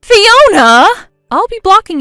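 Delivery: loud, high calling out her name; desperate yell. Acted. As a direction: yell after her as she leaves. Jen yells after blocking friend.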